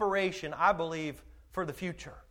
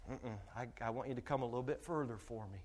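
soft, low, quick coaxing; the Lord coaxing; 7 semitones lower, 13 dB quieter, faster than usual. Acted. The Lord coaxing a hesitant believer further, soft and low. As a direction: coax gently and quietly. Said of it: "the Lord's out there saying".

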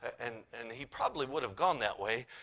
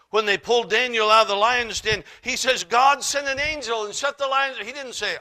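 loud, high, ringing scripture reading; preaching scripture; 11 semitones higher, 16 dB louder than usual. Acted. Preacher booming the Bible story to the church. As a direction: proclaim the king's words to the congregation.

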